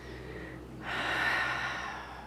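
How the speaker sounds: long, soft voiceless exhale; long sigh. Real, not acted. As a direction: let the breath out slowly and softly. Long exhale while waiting.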